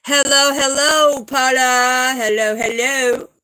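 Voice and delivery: put-on, drawn-out hello call; playful calling out. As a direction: call out in a silly held-note voice.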